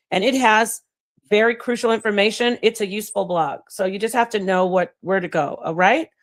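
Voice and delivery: normal talking voice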